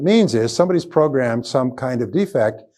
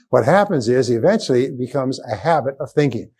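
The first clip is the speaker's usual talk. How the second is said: normal talking voice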